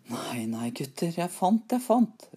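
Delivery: mounting excitement over a find; excited calling out. A narrator's reading. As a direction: climb step by step to a high cry. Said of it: "ropte han".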